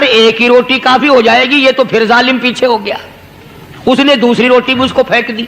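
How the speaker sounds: usual speaking voice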